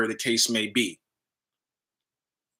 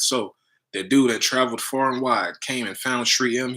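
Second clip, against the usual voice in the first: usual speaking voice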